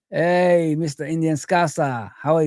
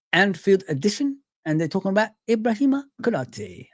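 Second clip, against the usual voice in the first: ordinary delivery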